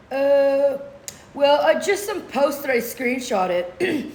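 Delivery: long dopey "uh", then slow answer; mock dim-witted man. Accuser explaining his concerns to the judge, mock dim-witted. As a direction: imitate someone stumped and stalling.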